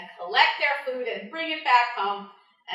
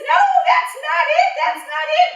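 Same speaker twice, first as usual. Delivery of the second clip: high, loud, exasperated no; frustrated cry; 13 dB louder than usual. Acted. Anansi shouting at bush deer that she got it wrong, exasperated.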